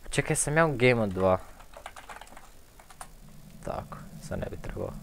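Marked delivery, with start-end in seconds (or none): 3.6-4.9 whispered to himself, very soft; barely voiced aside; muttered whisper. Real, not acted.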